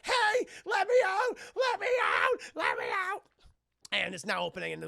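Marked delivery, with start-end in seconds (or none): 0.0-3.2 high, loud, desperate banging cry; high frantic shout; pleading shout. Retold or imitated. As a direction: beg to be let out. Mom locked in cellar.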